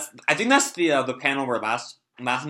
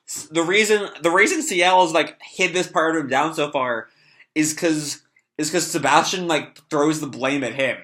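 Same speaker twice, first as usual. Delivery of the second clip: ordinary talk, nothing marked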